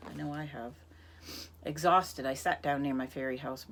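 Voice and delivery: ordinary delivery, nothing marked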